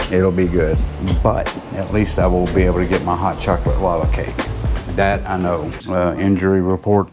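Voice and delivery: ordinary delivery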